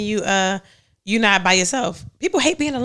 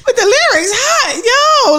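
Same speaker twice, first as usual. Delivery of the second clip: high, slower, laughing through it; laughing fake praise; 11 semitones higher, 5 dB louder, much slower than usual. Flattering the rapper boyfriend through laughter. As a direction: backpedal while cracking up.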